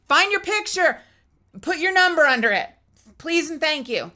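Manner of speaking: high, loud sing-song instructions; sing-song request. Retold or imitated. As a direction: chirp it brightly like a cheery order. Yearbook request acted out.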